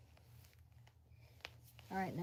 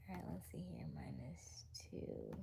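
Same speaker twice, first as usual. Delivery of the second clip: soft whisper while working sums; quiet muttered whisper doing math; 5 semitones lower, 12 dB quieter than usual. Real, not acted. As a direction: whisper the numbers to yourself.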